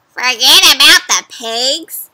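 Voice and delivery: loud, slow questioning repeat; puzzled witch. Acted. Witch repeating the wolf's advice in suspicious surprise. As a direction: echo it back in suspicious disbelief. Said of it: "said Gritch".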